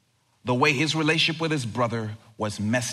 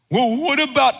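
mock protest; 11 semitones higher, 6 dB louder than usual. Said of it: "acting the quarrel".

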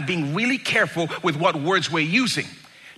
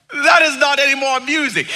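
high, loud sustained shout; hammed-up high yell; mock yelling; 10 semitones higher, 7 dB louder than usual. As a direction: shout the protest in mock outrage. Acted yelling in retold prank.

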